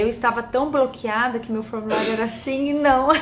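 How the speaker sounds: usual speaking voice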